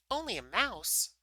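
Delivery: high, loud indignant mouse. A narrator's reading. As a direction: squeak it out, affronted. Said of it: "cried the little animal indignantly".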